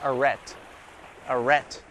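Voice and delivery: "arrête" said as uh-RET, soft; reading French stop sign. Shown as a demonstration. Reading stop sign to viewers, careful anglicized French. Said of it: "They say".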